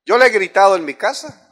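ordinary delivery

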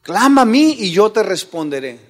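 loud, emphatic proclamation; God's call, preached. Retold or imitated. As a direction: declare the promise at full preaching voice. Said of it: "Eso es lo que Dios te dice".

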